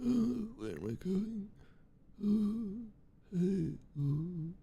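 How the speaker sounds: high, very soft, whispery voice; slow, faint little old-woman murmur; old lady muttering. Acted. Voicing Sophie wandering off.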